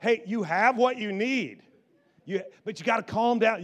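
ordinary delivery

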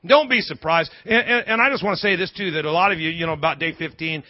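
ordinary talk, nothing marked

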